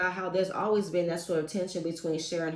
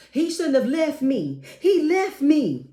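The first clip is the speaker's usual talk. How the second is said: high, loud, slowed hammed-up wail; mock wailing mom; 7 semitones higher, 6 dB louder, slower than usual. Mocking the mom who feels abandoned, high loud wail. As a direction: play the dramatic widow. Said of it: "the mom just gonna come out and say".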